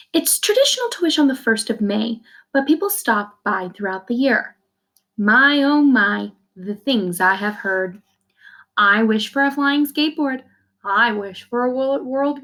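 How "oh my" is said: ordinary delivery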